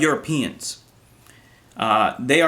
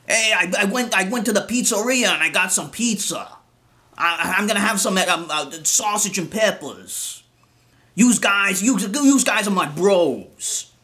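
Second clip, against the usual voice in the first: higher, hammed-up New Jersey Italian bro; mock Italian American accent; 4 semitones higher than usual. Mocking a wannabe with a fake Italian American accent. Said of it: "with that stupid bullshit accent".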